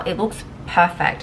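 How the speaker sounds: normal talking voice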